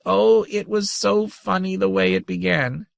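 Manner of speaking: high, gleeful creature voice; tiny grandmother teasing. A narrator's reading. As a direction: taunt him sweetly.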